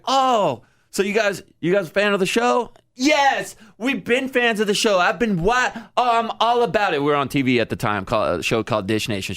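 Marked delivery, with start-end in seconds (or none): 0.1-2.7 flattered reply. Retold or imitated. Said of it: "And I'm like". 3.0-7.0 high excited fan voice; imitating gushing fan. Bride raving about the show, high gushing. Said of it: "And she goes".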